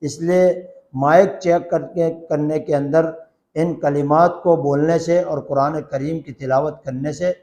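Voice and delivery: ordinary delivery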